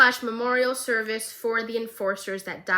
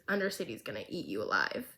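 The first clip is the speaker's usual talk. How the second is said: smirking; 9 semitones lower, 10 dB quieter, faster than usual. Retold or imitated. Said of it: "she's laughing and she's smirking".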